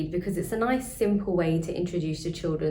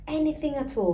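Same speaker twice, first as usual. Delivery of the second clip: twinkly; 9 semitones higher, faster than usual. Acted. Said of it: "with a twinkle in her eye".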